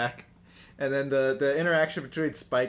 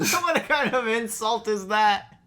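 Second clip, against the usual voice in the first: louder, slower incredulous voice; mock incredulous; 8 semitones higher, 5 dB louder, slower than usual. Real, not acted. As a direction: act baffled and outraged. Mock outrage at insult.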